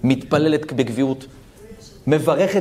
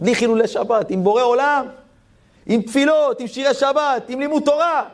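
rising blessing; 11 semitones higher than usual. Acted.

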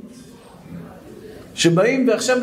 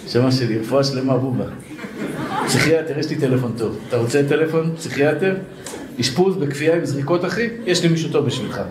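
mock concern; 6 semitones lower than usual. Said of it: "או שאתה מסתכל עליו, אומר".

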